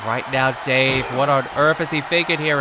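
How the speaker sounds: ordinary talk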